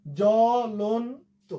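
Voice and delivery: জ্বলন্ত drawn out, second syllable lon rising; slow pronunciation demo. Shown as a demonstration. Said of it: "দেখো". (জ্বলন্ত টেনে টেনে, ভেঙে উচ্চারণ)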